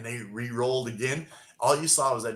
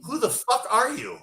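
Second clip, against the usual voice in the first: higher, outraged demand; 12 semitones higher than usual. Retold or imitated. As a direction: challenge him with disbelief. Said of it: "And she goes".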